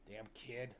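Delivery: usual speaking voice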